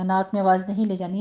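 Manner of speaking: usual speaking voice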